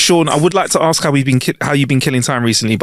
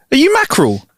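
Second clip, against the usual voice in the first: asking the fish; 11 semitones higher than usual. Retold or imitated. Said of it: "so I asked it".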